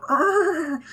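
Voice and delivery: high, loud anguished Chewie howl; imitated Wookiee roar. Chewie shot in the movie, high loud wounded roar. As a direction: let out a wailing Wookiee cry. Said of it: "the sound he makes is heartbreaking".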